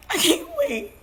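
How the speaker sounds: crying over her lost daughter; breaking into tears. Real, not acted. A mother breaking down into sobs over her dead child. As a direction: voice cracks high as she starts to cry. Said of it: "I'm not trying to cry".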